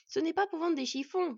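high, loud call through a door; shouting back. A narrator's reading. Wolf woman to the dwarf behind the door, shouting. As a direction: shout so they hear you inside. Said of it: "cria à son tour la vanne".

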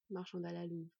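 ordinary delivery, nothing marked

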